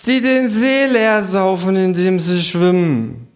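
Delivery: hohe, gedehnte Figurenstimme; Känguru. Acted. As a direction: langsam und hoch verkünden, am Ende absinkend. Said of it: "sagt das Känguru". (hohe Känguru-Stimme, feierlich gedehnt)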